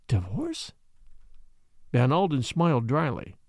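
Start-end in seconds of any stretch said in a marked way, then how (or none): 0.1-0.7 soft, dry echo of divorce? with a rising lilt; divorce? repeated quietly, lightly mocking her shock. A narrator's reading. Father echoing his daughter's shocked word, dry. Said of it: "You say that word".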